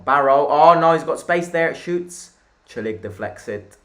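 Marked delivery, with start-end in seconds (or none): 0.0-2.3 louder, higher, urgent play-by-play; alarmed commentary. Real, not acted. Streamer watching opponent find space to shoot, alarmed. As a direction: raise the alarm as the attack builds.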